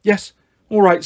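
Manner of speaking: ordinary delivery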